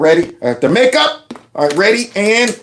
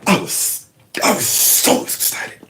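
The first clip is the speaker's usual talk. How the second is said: hammed-up tearful whisper, mocking; barely voiced fake choking up; overacting; 5 semitones lower, 6 dB quieter than usual. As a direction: imitate a man overacting his emotion, softer.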